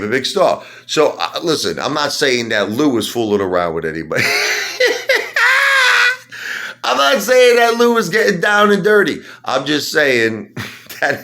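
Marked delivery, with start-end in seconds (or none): 4.3-6.8 shrieking laugh. Real, not acted. Ranting vlogger cracks up mocking Lou, shrieking laugh. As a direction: cackle in disbelief.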